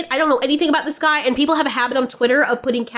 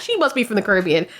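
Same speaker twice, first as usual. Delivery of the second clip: ordinary delivery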